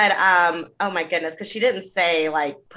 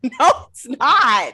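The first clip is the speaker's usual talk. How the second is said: drawn-out, higher, louder mock protest; loud, slow, exaggerated contradiction; exasperated retort; 6 semitones higher, 7 dB louder, much slower than usual. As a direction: stretch out an incredulous denial. Mock retort to her claim.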